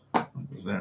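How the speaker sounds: soft unvoiced whisper to himself; whispered aside. Real, not acted. Arranging his desk, whispering to himself where each thing goes. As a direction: breathe it out under your breath.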